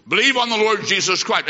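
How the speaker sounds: ordinary talk, nothing marked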